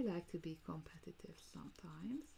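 whisper. Real, not acted. Playful confession to viewers.

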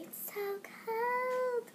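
put-on high, very slow little-kid whine; mock toddler shivering. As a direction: drag it out like a cold toddler. Baby self freezing again.

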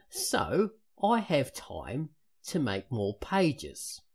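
slow, loud Cockney with big pitch swings; hammed-up Cockney sliding into Michael Caine; Cockney accent. Shown as a demonstration. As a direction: read it as a Michael Caine style Cockney, stretched and swooping. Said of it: "that was more Michael Caine than anything else".

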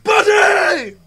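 very high, blaring held yell; mock roll-call scream. Friend playing the screaming counselor, mock scream.

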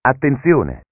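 normal talking voice

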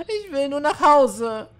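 high, slow, small plea to go home; tearful girl. Acted. Scared girl pleading with her manager to go home, high and slow. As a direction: draw it out like a lost child.

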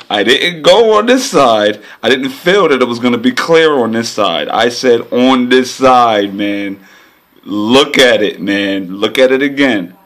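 loud, high exasperated venting; talking loudly, fed up with himself; loud frustration. Real, not acted. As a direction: hammer the point home in frustration. Venting loudly about missed pick.

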